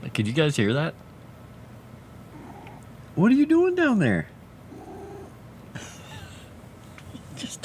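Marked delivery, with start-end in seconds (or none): 3.1-4.3 much higher, cooing voice for the cat; pet voice. Real, not acted. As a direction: coo down at the kitty. Talking to the cat.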